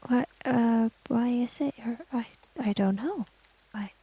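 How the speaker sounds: usual speaking voice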